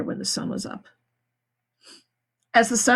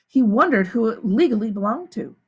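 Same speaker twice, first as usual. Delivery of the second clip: plain reading voice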